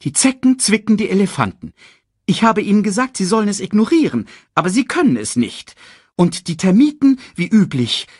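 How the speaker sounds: high, loud fussy bird reporting in; fussy bird secretary. Acted. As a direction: chatter out the report as the king's bird. Reads Sasu, the king's fussy bird.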